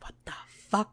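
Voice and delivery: hushed, breathy whispered curse; whispering. Retold or imitated. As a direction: whisper it in disbelief. Stunned character swears under breath.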